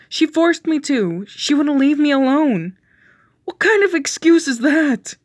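high, loud frantic argument; heated shouting. Acted. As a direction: voices rising over each other. Heated argument between boyfriends.

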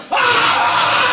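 high mock shriek of a madman; straitjacket scream. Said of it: "I'm going".

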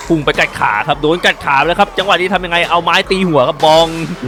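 live play-by-play. Acted. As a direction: call the battle like a sportscaster. Calls the dragon fight live. (พากย์การต่อสู้สดอย่างตื่นเต้น เสียงสูงและดัง)

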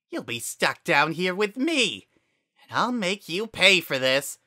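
high, loud snarling threat; menacing threat. Acted. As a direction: promise revenge. Flowey threatens, mouth toothy.

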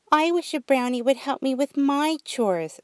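put-on high, wishful little girl; a bright child's wish; young girl voice. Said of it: "said Brooke".

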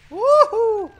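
high loud whoop; soaring excited woohoo; victory whoop. Acted. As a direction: celebrate with a big whoo-hoo. Game character victory cry.

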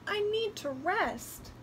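put-on high, slow little-girl voice; tired little girl. A narrator's reading. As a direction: drag it out as a worn-out child. Tired girl needs rest.

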